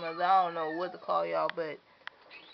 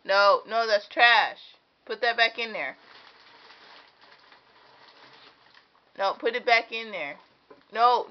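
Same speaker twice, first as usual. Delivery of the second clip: firm scolding; 4 semitones higher, 7 dB louder than usual. Real, not acted. Mother stopping her baby from grabbing trash, loud and firm.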